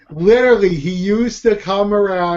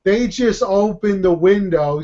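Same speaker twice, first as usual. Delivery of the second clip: usual speaking voice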